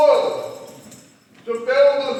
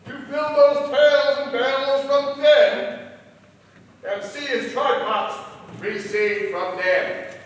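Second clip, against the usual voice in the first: poem recitation; 10 semitones higher, 9 dB louder than usual. Acted. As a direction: recite it like a ballad.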